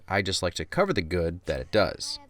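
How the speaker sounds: ordinary talk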